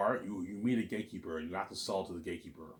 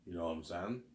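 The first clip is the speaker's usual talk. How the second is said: ordinary delivery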